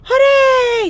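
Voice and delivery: very high, loud squealed cheer; overjoyed mouse. A narrator's reading. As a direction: cheer as a delighted little mouse.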